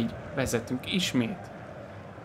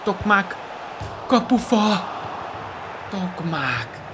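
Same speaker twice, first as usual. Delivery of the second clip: excited sports commentary; 7 semitones higher, much slower than usual. Real, not acted. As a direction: shout the near miss like a commentator. Shot hits the post.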